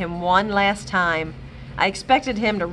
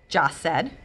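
ordinary delivery, nothing marked

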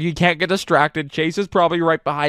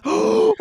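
sharp high gasp. Real, not acted. Spotting another guard.

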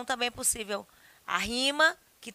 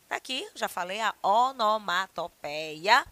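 ordinary talk, nothing marked

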